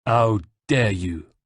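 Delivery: slow, contained outrage; enraged. A narrator's reading. De Valois answers the insult, restrained rage. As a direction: draw out the affront with restrained fury.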